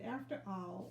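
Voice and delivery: usual speaking voice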